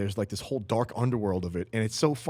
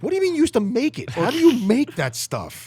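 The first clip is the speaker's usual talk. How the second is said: much higher, louder, fast disbelief; incredulous; 13 semitones higher, 10 dB louder than usual. Retold or imitated. Reacting to the dealer who made it himself, high incredulous. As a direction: squeal it out in shock. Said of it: "I'm like".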